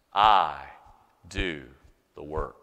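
very low, word-by-word "I... do... the work"; acted low, halting pronouncement; self-reliant answer. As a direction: drop deep and space out each word. Deadpan answer to own question.

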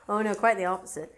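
usual speaking voice

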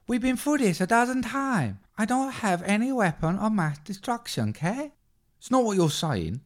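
high squeaky Team America puppet voice; Kim Jong-un impression. Kim Jong-un from Team America whining at Don Logan. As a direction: go way up, whiny puppet dictator. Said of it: "This is when Kim Jong-un".

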